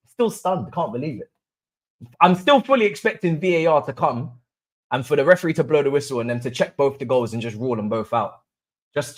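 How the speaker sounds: normal talking voice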